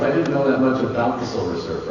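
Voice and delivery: ordinary delivery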